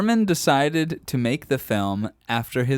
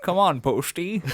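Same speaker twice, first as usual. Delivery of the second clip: mock Connery; 4 semitones higher than usual.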